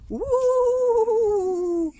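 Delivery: very high, loud ghostly woo. Acted. Little skeleton dressed as ghost, loud ghostly wail.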